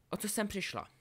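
understated, high-pitched query; dry question. A narrator's reading. Ginny asks the duellists what happened, dry. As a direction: ask it drily, no shouting. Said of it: "zeptal se naprosto suše".

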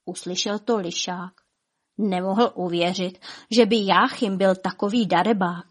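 ordinary delivery, nothing marked

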